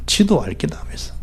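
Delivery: breathy whispered mimicry; whispered aside. Preacher whispering a Gyeongsang phrase to the congregation. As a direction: drop to a whisper for the punchline.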